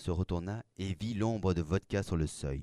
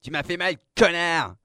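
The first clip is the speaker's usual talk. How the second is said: high, loud outraged shriek; angry yell; 9 semitones higher, 8 dB louder than usual. A narrator's reading. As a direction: scream the insult at your attacker. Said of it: "hurla Creux de Vague". (hurlement aigu et furieux)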